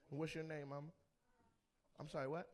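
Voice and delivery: ordinary delivery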